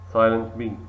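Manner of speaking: ordinary talk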